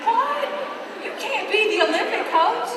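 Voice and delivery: high, loud incredulous "What?"; shocked disbelief. Retold or imitated. Friend at breakfast, stunned and loud disbelief. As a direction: friend nearly choking on bacon, loud disbelieving cry. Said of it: "She's like".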